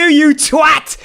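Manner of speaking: high Cockney insult; mock Cockney. Gamer ending his Cockney bit, hammed-up. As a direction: spit it like a Cockney lad. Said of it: "his vocabulary for a Cockney is just twat".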